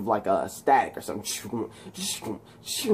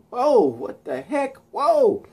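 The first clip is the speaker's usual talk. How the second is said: high, drawn-out gawking; slow, high-pitched amazement; mock astonishment; 12 semitones higher, slower than usual. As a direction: act out a stunned bystander. Voices startled bystanders in story.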